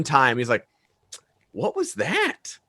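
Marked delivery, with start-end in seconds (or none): 1.5-2.6 high, soft, drawn-out question; imitated wondering character. Retelling that one time in the film, softly wondering. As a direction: imitate someone puzzled by a sound, slowly and softly.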